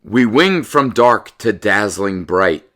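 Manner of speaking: loud, slow storybook reading; picture-book read-aloud, slow and lively; reading to children. Acted. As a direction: read the rhymes out to the class with relish.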